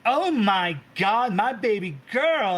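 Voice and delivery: high, loud, slow astonishment; amazed sister. Acted. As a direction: gasp in proud awe. Sister sees drinking feat.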